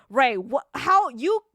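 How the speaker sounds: loud, sputtering scolding; hammed-up outraged group telling-off; mock reprimand. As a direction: scold the culprit in loud disbelief. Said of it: "and everyone's like".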